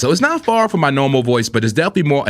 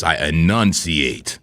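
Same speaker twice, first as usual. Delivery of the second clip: slow, deep announcer voice; radio voice; 8 semitones lower, much slower than usual. Shown as a demonstration. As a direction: enunciate every syllable slowly. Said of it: "when I get into my radio voice".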